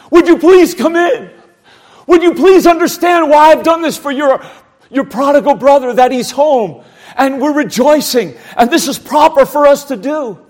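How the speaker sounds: loud, high begging plea; pleading. Retold or imitated. Father begging his angry older son to come in, pleading. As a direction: beg him to come in.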